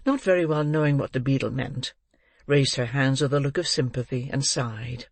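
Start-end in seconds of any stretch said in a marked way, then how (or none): none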